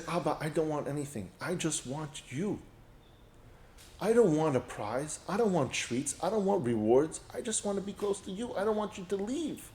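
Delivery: put-on hushed, tender child's plea to a parent; soft plea. Daughter begging her father to stay, soft plea. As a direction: say it very softly, imploring, with small pauses.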